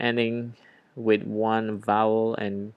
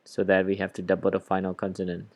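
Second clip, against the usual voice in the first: usual speaking voice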